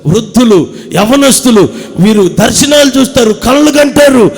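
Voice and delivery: loud, fervent sermon delivery, slowed and stretched; full-force preaching. Acted. Preacher calls congregation to be filled, preaching full force.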